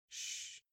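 sharp whispered shush; a quick whispered shh to silence him; hushing shh. Acted. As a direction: hush him urgently. Hushing his cousin on the plane.

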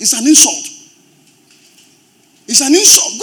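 loud, emphatic outcry; indignant shout. Real, not acted. Preacher to congregation about exploitative pay, indignant shout. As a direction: hammer it out at high volume.

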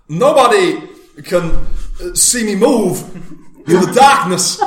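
high, loud, haltingly phrased ninja line; loud impression with odd pauses between phrases; Christopher Walken. Shown as a demonstration. Showing off the one learned game line, loud Walken impression. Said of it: "do that line perfectly in Christopher Walken's".

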